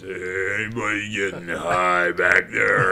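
slow, loud drawn-out stoner call; weird voice. Retold or imitated. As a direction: stretch every word out, sinking low by the end. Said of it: "this, like, weird voice".

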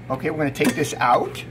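ordinary talk

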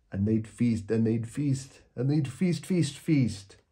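sing-song repetition rising up; mock feasting sing-song. A narrator's reading. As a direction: pitch climbing on each feast. Narrator mimics endless feasting.

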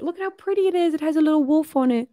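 high-pitched delighted cooing; happy gushing. Real, not acted. Showing off a pretty new bookmark, happy gushing. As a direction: gush in a small, high, happy voice. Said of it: "I'm so happy right now".

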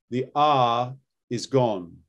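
ordinary talk

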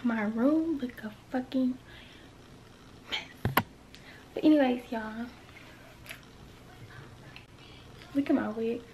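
hushed half-whisper to camera. Real, not acted. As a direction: keep it quiet, barely voiced. Hushed recording, voice low.

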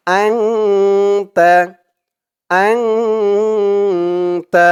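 আন্তা slow, nasal আং held for a count. Shown as a demonstration. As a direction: linger on the আং of আন্তা. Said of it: "আং বলে আবার গুণনা করতে হবে".

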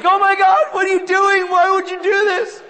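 shocked waitress in a packed restaurant, loud and high; overwhelmed outcry. Retold or imitated. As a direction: cry out as if the gift is too much.

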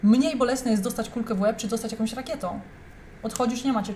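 normal talking voice

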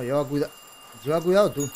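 normal talking voice

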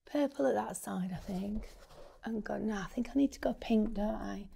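soft whispered musing; whispering. Real, not acted. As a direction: whisper while deciding. Thinking aloud while working.

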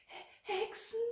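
very high, stammered gasp of a question; frightened, halting squeak; barely intelligible fear. A narrator's reading. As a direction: stutter it out in a tiny high voice. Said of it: "Gertrud war kaum noch zu verstehen".

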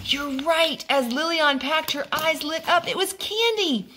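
high, bright excited reading. Real, not acted. Mom thrilled reading the candy reveal to kids. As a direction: build wonder in the voice.

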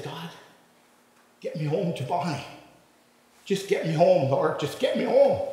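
urgent retold plea to God, climbing in pitch; pleading prayer. Desperate prayer in a scary ride.

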